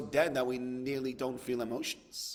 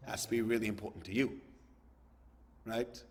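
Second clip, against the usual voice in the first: ordinary talk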